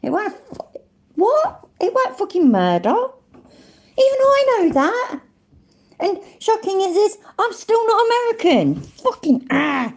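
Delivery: very high, squeaky disbelief; real exasperated astonishment; incredulous. Real, not acted. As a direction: protest in a baffled, squeaked pitch. Disbelief at the clip's murder claim.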